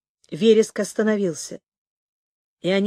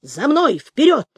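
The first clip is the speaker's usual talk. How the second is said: rising, louder call to charge; rally the troops, louder and higher on the last word; barked command; 4 semitones higher, 3 dB louder than usual. A narrator's reading. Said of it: "гаркнул Дурман". (громкая команда генерала)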